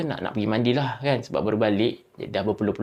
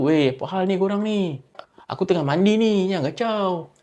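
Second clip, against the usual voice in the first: loud, higher shout, brave but scared; scared angry shout; 7 semitones higher, 4 dB louder than usual. Retold or imitated. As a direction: force some courage into the yell. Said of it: "Ha, dia macam tu lah". (berteriak marah tapi takut)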